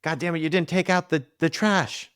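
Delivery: high, sharp scolding over the trash; angry partner. Acted. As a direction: snap at him like an irritated partner.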